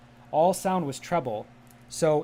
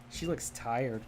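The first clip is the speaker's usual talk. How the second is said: ordinary talk, nothing marked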